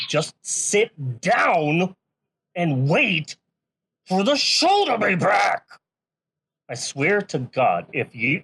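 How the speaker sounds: loud menacing barbarian threat. Acted. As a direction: roar it like a brute warrior. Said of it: "threatening listeners to stay seated".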